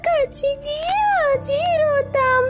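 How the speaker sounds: put-on high, slow, respectful young voice; slow, high, humble girl addressing the fish queen.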